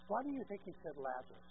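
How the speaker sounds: usual speaking voice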